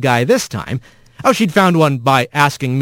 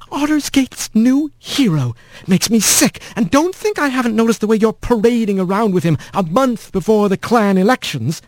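put-on hateful old aunt; 8 semitones higher than usual. A narrator's reading. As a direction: spit out contempt without raising the voice. Sour aunt Meline voiced.

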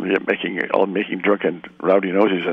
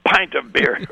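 hearty drinker; 7 semitones higher than usual. Retold or imitated. Pub bully retold, won over, laughing.

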